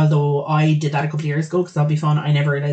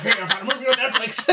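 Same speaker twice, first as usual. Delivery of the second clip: creepy teddy voice; 7 semitones higher than usual. Retold or imitated. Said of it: "He's like".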